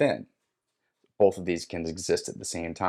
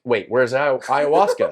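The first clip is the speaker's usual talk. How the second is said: raised, louder puzzled question; startled confusion; 4 semitones higher, 6 dB louder than usual. Retold or imitated. Seeker hearing own voice instead of spirit's, startled. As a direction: blurt it higher, searching for who's missing.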